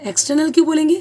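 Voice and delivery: normal talking voice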